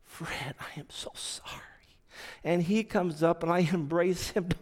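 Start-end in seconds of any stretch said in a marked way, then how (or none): 0.0-1.6 choked whisper. Retold or imitated. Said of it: "Dennis lost it".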